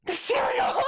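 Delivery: high, aghast cry of dismay; shocked mom. Retold or imitated. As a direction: sound like a startled mother. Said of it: "my mom was like".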